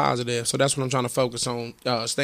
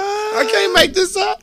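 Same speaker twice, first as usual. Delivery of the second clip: laughing disbelief; 7 dB louder, slower than usual. Real, not acted.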